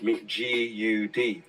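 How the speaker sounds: G U D spelled out slowly, letter by letter. Shown as a demonstration. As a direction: spell G U D deliberately. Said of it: "from the word God".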